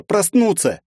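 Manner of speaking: begging to wake, voice climbing; desperate repeat. A narrator's reading. Повтор, голос срывается выше. (отчаянный повтор, выше)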